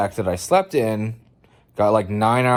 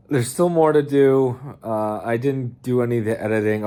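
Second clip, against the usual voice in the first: usual speaking voice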